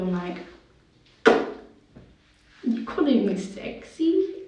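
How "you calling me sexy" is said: slow, higher, playfully teasing question; mock flattered. Teen joking to viewers about looking like mum, mock flattered. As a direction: act cheekily flattered, drawing it out. Joking aside after a compliment.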